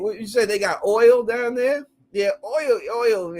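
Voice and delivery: ordinary talk, nothing marked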